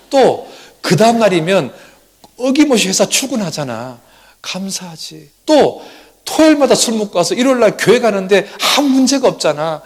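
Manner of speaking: acted high, loud, mounting enthusiasm; eager listing. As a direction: pile up each reason with rising energy. Wife eagerly lists reasons for gratitude.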